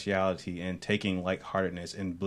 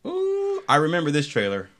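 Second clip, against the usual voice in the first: excited; 9 semitones higher, 6 dB louder than usual. Real, not acted. Excited about Thor trailer.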